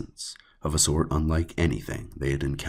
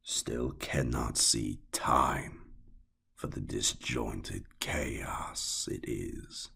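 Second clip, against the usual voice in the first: very slow, softer, murmured musing of a dark god; slow sinister murmur; 3 semitones lower, 6 dB quieter, much slower than usual. Acted. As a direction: let it sink to a whisper at the end.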